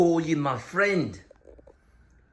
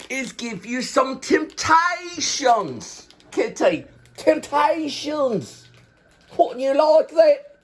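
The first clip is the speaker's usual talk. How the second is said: higher, coaxing treat offer; enticing the cat; 8 semitones higher than usual. Real, not acted. Owner luring the kitty with Temptations treats, coaxing and high. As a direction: Temptations drawn out slow and high to lure her.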